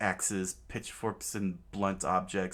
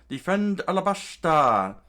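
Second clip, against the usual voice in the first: rallying shout; 10 semitones higher, 10 dB louder than usual. Acted. Chaka ordering the guards, loud war cry. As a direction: Defend Alabasta shouted loud and high. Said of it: "screamed out to all the guards".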